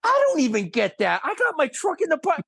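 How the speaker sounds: high-pitched comic protest; mock indignation. Director protesting the star gets the trailer, mock-indignant.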